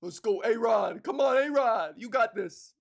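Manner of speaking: New York fan yelling encouragement; loud cheering. Acted. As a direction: cheer on the batter from the stands, loudly. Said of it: "Just cheering".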